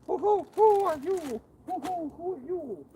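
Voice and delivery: high, slow hooting owl voice. Retold or imitated. Angler mimics the owl hooting across the water, high and slow. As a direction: hoot each who up high like an owl. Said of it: "That owl's like".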